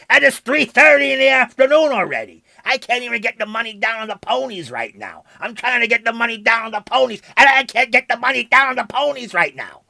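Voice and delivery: thick New York accent, hammed-up wise guy. Streamer griping to chat about the outage, in a New York accent. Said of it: "You like my New York accent here?".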